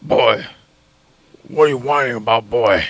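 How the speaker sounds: low gruff-father impression; deep, dismissive warrior father; Kratos. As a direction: drop way down into a stern dad's voice. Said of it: "Kratos is purring".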